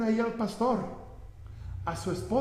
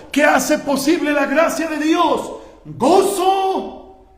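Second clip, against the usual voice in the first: loud, raised question with a ringing answer; impassioned preaching shout; 4 semitones higher, 8 dB louder, slower than usual. Real, not acted. Preacher calls the answer to his congregation, shouting. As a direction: thunder it out to the congregation.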